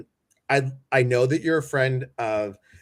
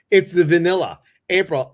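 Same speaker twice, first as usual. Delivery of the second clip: usual speaking voice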